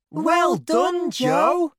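very high-pitched cheer; children's cheering shout. Acted. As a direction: shout it like excited kids congratulating a friend. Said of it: "shout the children".

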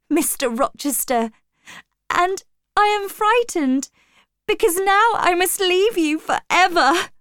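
high, pleading outpouring on the edge of tears; acted upset, pitched high all through; tearful distress. A narrator's reading. Jane tells her master why she cannot bear leaving, tearful distress. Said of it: "suddenly I began to cry".

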